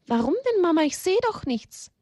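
put-on very high, questioning child voice; puzzled little child. Child sees no danger in hole, high puzzled voice. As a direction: protest innocently in a small, high voice.

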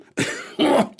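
put-on old man's sudden cough; acted coughing fit. A narrator's reading. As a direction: break off into coughing. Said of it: "bekam wieder einen Hustenanfall". (gespielter Hustenanfall)

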